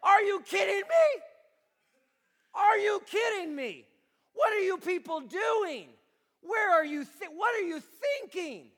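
high, loud, disbelieving rant; exasperated rant. Retold or imitated. Paul scolding the church, a loud high exasperated rant. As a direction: scold the people, punching each question. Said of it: "He's like".

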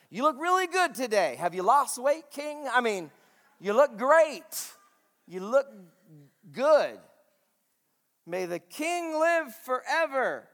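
high, loud gushing compliments; preacher hamming up flattery of the king; over-the-top flattery. Acted. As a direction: gush shamelessly to win him over. Acted buttering up the king.

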